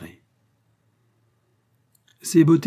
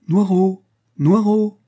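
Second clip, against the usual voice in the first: high, loud call to a dog; summoning the dog from afar; 9 semitones higher, 7 dB louder, slower than usual. Acted. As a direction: shout the dog's name across the yard. Woman calls her dog.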